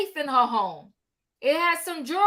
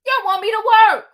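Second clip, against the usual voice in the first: higher, louder, fast complaint; exasperated outburst; 5 semitones higher, 9 dB louder, faster than usual. Real, not acted. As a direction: vent at the cheating viewers. Scolding viewers for not liking.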